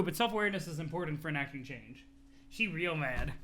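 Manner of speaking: ordinary talk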